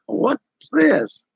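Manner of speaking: high, baffled question; mock the confused bouncer; puzzled doorman. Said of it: "He goes".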